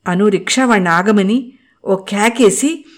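ordinary delivery

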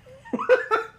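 sudden high squealing laugh; high burst of laughter. Real, not acted.

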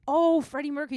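high, loud dawning realization; sudden recognition. Retold or imitated. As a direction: re-enact finally getting who it is. Acting out his moment of recognition.

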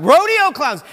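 high, loud mimicked outburst; imitated excited shout. Preacher imitates brother repeating his idea, high excited shout. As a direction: cut in hollering, as your excited brother would. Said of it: "and he shouts out".

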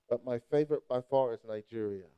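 usual speaking voice

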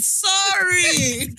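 high, drawn-out retort; affronted sorry. Retold or imitated. Guest answers friend's sister-in-law's remark, affronted. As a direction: stretch it out in mock offence. Acting out her offended reaction.

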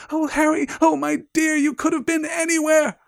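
very high, loud, fussing mother; frantic mother. Acted. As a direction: wail with frantic worry and relief. Reads frantic mother finding Harry.

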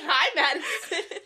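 loud, high greeting through laughter; mock-mean greeting. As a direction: drag out the hello, laughing. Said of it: "she said I was mean when I said it".

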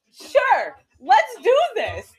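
high and slow, gung-ho; eager kid. Retold or imitated. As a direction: sound cheerfully up for it. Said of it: "I was just like".

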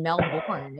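Melbourne said "mel-BORN"; wrong pronunciation. Shown as a demonstration. Host admitting the mistake that got her told off, Melbourne as "mel-born". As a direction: say Melbourne as spelt, with a full "born". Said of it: "got yelled at for saying".

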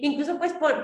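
ordinary talk

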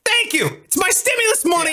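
high put-on voice; mock grateful voice. Host mocking himself handing out cash, high put-on voice. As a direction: squeak it out like a cheesy giver.

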